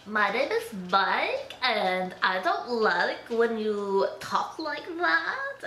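slow, drawn-out silly voice; weird mocking voice. Shown as a demonstration. Girlfriend making fun of boyfriend Mike, slow weird voice. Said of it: "go into this weird voice and go".

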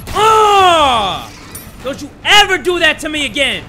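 high, loud startled shout; startled scream. Real, not acted. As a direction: yell after a scare. Jump scare then scolding.

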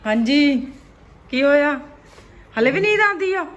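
loud exasperated scolding; dramatic loud aunty. Real, not acted. Mother loudly scolding sleepy son.

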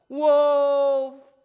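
high, loud fake alarm cry; yelling. Boy pretending a wolf is there, yelling to the villagers. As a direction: shout for help to fool the village. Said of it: "the boy yelled".

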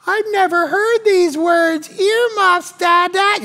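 put-on high, slow voice of frightened little girls; shocked kids. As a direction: play the daughters pleading with their dad. Contractor voicing the shocked daughters.

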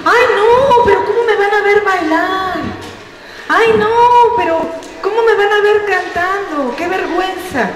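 voz aguda de adulto que se muere de vergüenza; quéjate agudo, como quien no quiere bailar; adulto avergonzado. Retold or imitated. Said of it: "Y dices". (imitando a un adulto apenado, voz aguda)

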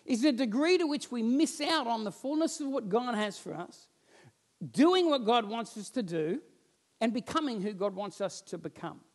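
usual speaking voice

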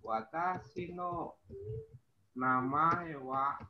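very slow, word by word; slow demo answer. Shown as a demonstration. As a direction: lay out each word for students. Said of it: "kalau kita jawab secara baku".

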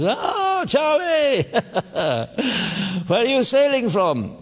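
high mock-posh yacht club gent; fellow from the yacht club. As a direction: ham up a plummy sailor hailing Charlie. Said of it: "who says".

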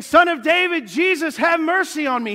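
loud, high, sustained shout; desperate cry over the crowd; Bartimaeus crying out. Acted. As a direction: holler a plea for mercy. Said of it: "Bartimaeus is saying".

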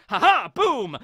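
loud high gloating boom; hammed-up attack shout; battle cry. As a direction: strike with a triumphant laugh. Garling's attack on Whitebeard.

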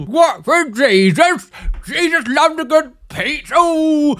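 put-on slow, high, loud invented accent; Jesus accent. The tattooed Jesus calling out, silly invented accent. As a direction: crow it in a daft made-up Jesus accent. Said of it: "That's Jesus' accent now".

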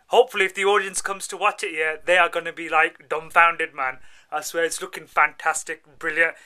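loud, hyped-up talk; pumped and loud about the film; excited. Real, not acted.

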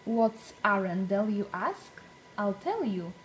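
usual speaking voice

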